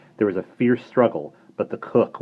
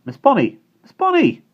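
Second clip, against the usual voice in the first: put-on high, loud boyish call over the railings; eager boy calling; 9 dB louder than usual. Simon calling to Bonnie through the railings, high and eager. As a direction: call out to her in a young boy's raised voice. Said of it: "a familiar whisper".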